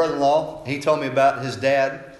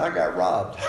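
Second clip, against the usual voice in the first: laughing mock complaint; 7 semitones lower, slower than usual. Brother's comic complaint retold.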